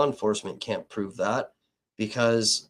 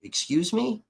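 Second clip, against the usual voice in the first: high, stammered "W-, excuse me?" in disbelief; incredulous excuse me; 7 semitones higher than usual. Retold or imitated. Retelling his reaction to a source, stunned and high. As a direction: pitch jumps up in shocked disbelief. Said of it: "And I'm like".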